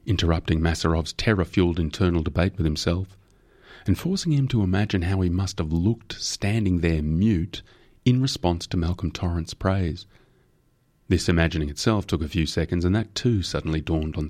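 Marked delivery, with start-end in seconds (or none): none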